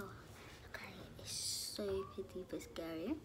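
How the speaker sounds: slow, nervous whisper. Real, not acted. Prankster to viewers inside the house, whispering. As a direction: confide it in a hushed whisper.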